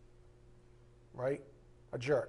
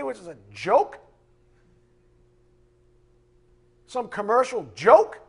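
mock incredulous; 5 semitones higher, 7 dB louder than usual. Mocking petty complaints.

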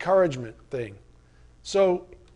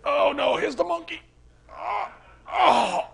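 much higher, whimpering mock groan; mock dismay; 11 semitones higher, slower than usual. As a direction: groan as if the burden just landed. Congregation groaning at appeal.

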